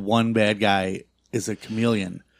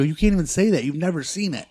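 high, indignant protest; retold anger; 8 semitones higher than usual. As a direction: act out scolding the wife for judging. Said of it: "I got so angry".

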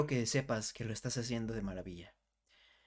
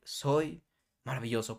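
"Soy", a pause, then "maravilloso" drawn out; slow it down and let the words land apart; unhurried affirmation with a pause after "Soy"; said slowly. Shown as a demonstration. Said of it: "lento, como tú quieras".

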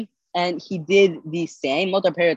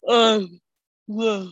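yawning; 3 semitones higher than usual. Real, not acted. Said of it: "I don't know why I'm yawning so much".

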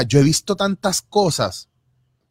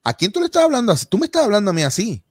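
indignant retort; 8 semitones higher, faster than usual. Retold or imitated. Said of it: "yo los paro y digo".

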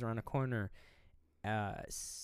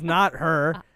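loud mock quote; 6 semitones higher, 11 dB louder than usual. Laughing retelling, still loud.

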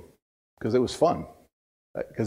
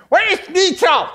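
comic high-pitched shouting impression; hollered threat; 5 dB louder than usual. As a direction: imitate a furious man bellowing across a warehouse. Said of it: "I hear him going".

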